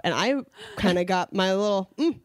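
ordinary talk

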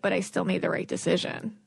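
calm inner voice. Acted. Source self answering someone's disappointment, calm and quiet. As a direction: say it softly, simply sure of yourself. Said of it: "It's not loud or obnoxious".

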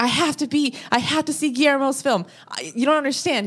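desperate plea. Retold or imitated. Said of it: "I begged them".

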